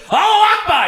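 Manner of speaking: high, loud sudden shout; mock passenger's cry. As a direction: leap up and yell it down the aisle. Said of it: "someone jumps up in the middle of the aisle and goes".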